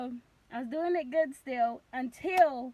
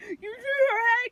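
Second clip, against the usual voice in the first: high, loud, mushy cry; muffled by mask; 10 semitones higher, 10 dB louder than usual. Retold or imitated. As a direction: shout it as if through a mask. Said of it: "'Cause I got the mask on".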